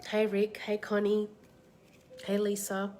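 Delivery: normal talking voice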